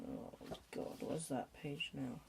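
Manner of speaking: usual speaking voice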